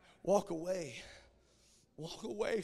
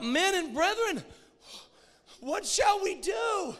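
loud, higher desperate cry; crowd shouting; 10 semitones higher, 8 dB louder than usual. Retold or imitated. The crowd crying out to Peter, loud and desperate. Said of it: "they shouted out".

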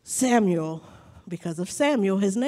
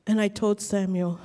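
ordinary talk

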